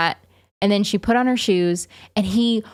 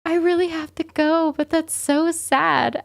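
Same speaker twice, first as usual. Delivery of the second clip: higher pleading voice, torn about leaving; mock heartbroken plea; imitating Anna torn; 10 semitones higher than usual. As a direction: mimic a sad mom who has to go to work. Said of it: "She was like".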